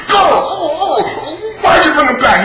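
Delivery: high mock angry yell; mock angry Taiga. Mimicking Taiga's pointless anger, high and yelled. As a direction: bark it like a hothead, hammed up. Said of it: "He just gets angry".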